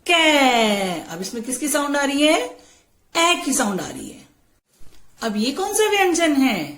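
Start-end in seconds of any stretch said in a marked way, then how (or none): 0.1-1.0 "कै" drawn out long with a falling tone; ai-sound demonstration. Shown as a demonstration. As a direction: stretch the vowel in "कै" for learners. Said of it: "क्या बोलेंगे इसे".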